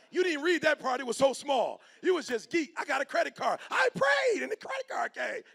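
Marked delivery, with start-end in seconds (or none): 2.8-5.4 mock naive believer. Mocking a naive credit card owner.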